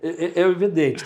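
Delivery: loud, laughing agreement; real laughter spilling into the words. Real, not acted. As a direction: still laughing as you agree. Still laughing after the joke.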